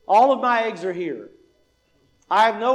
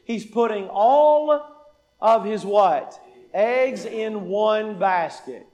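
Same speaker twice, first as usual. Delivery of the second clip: slow, fill-in-the-blank; slower than usual. Shown as a demonstration. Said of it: "what is this man doing".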